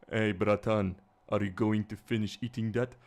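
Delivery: mock Eastern European accent, punched out. Speaker showing what Eastern European accent means, mock Eastern European accent. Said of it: "when you say Eastern European accent, it's more like".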